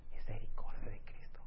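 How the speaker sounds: soft unvoiced whisper; whispered misericordia de Cristo. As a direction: hush it as if sacred. Quiet key word in sermon.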